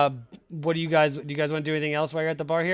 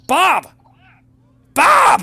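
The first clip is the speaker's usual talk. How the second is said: high, very loud yell across the tavern; yelling for Bob; 15 dB louder than usual. Acted. Barmaid yells across the tavern at the bartender, very loud high shout. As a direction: bellow his name at the top of your lungs. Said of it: "she yells".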